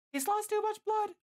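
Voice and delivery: very high, slow falsetto woman's voice; worried Licia. A narrator's reading. Licia sees the wound is bleeding badly, worried falsetto. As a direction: voice her alarm in high falsetto.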